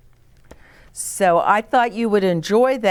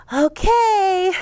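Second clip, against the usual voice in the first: put-on higher, louder, eager little girl; Nellie's voice; 12 semitones higher, 5 dB louder than usual. A narrator's reading. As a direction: chirp it up high as Nellie. Nellie agrees to swoop.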